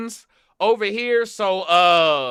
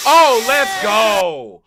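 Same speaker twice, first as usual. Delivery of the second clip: hyped; 4 semitones higher, 10 dB louder, much slower than usual. Real, not acted. Hyped at the video.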